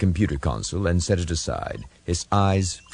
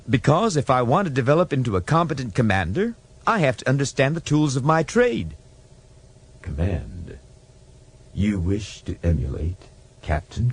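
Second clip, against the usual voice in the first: earnest ensign; 6 semitones higher than usual. Acted. Ensign explaining to computer.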